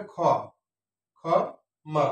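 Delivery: খ as 'kho', pause, then মা; syllable by syllable. Shown as a demonstration. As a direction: isolate খ before adding মা. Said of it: "তাহলে এখানে কি আসবে". (খ... মা, ভেঙে ভেঙে)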